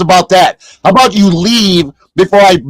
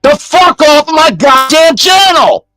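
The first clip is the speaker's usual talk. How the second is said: pitched-up furious tirade at a viewer; angry shouting; 8 semitones higher than usual. Real, not acted. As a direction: shout him off the channel in real rage. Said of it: "Why do you think I'm so mad?".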